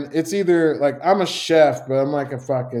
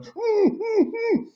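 very high squeaky mock whimpers; imitating a panicked whimper; much slower than usual. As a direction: squeak out a frightened hum. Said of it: "And she was like".

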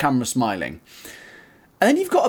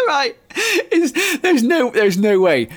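laughing disbelief; 12 semitones higher, 4 dB louder, much slower than usual. Real, not acted.

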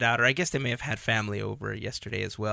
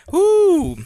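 loud high whoop; excited whoop; 13 dB louder than usual. Real, not acted. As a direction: cheer it out. Cheering after the song.

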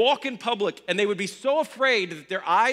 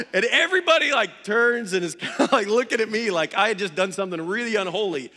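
usual speaking voice

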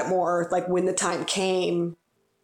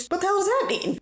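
mock exasperation; 13 semitones higher, faster than usual. Mock outrage about fish sandwich.

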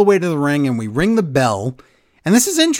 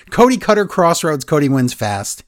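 usual speaking voice

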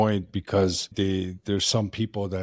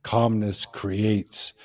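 slow quote; much slower than usual. Retold or imitated. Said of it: "famously used to say".

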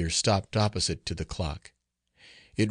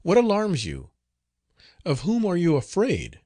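high-pitched, concerned questioning; surprised, swooping high questions; astonished; 8 semitones higher than usual. A narrator's reading. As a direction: ask in startled alarm. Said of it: "replied the astonished Jacinta".

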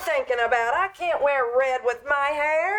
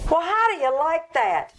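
ordinary talk

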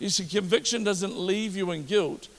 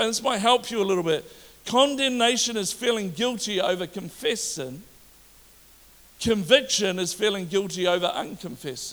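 raised, insistent sermon voice; preaching; 5 semitones higher than usual. Acted. Pastor preaching hard to his congregation, raised and insistent. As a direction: preach it out to the church.

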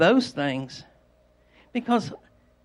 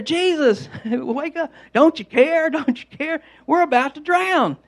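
urgent, high, raised pleading; panicked disciples; 7 semitones higher, 4 dB louder than usual. Acted. Disciples waking Jesus in the sinking boat, panicked pleading. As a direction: cry out in alarm to rouse a sleeper.

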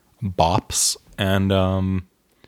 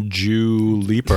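slow, lightly put-on British pronunciation; British accent; slower than usual. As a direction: [name] as a mock Brit says it. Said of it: "as the British say".

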